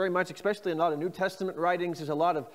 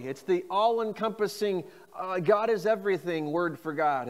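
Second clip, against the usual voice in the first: ordinary talk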